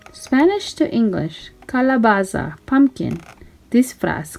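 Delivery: usual speaking voice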